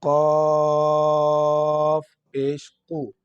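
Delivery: قاف پیش قو held very slow, lips rounded on قو; rounded-lips demo. Shown as a demonstration. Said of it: "ہمارے ہونٹ جو ہے وہ گول ہو جاتے ہیں".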